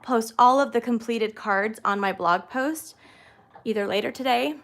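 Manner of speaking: ordinary talk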